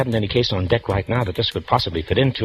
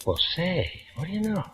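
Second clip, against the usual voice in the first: surprised; 8 semitones higher, 11 dB quieter than usual. Acted. Detective notices her without glasses.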